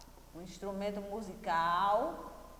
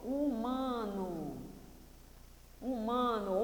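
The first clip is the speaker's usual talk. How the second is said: softer, very slow dictation of one word; drawn-out word; 6 dB quieter, slower than usual. Shown as a demonstration. Teacher reading the answer to pupils, slow and drawn out. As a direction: say it slowly, stretching each syllable.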